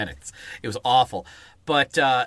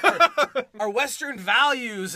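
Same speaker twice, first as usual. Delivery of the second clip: high, slow mock-patriotic voice; mocking pundit; 10 semitones higher, much slower than usual. Mocking how pundits talk, pompous patriotic voice. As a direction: sneer out the slogan pompously. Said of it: "mocking pundit".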